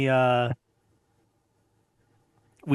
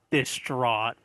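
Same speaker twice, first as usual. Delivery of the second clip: ordinary talk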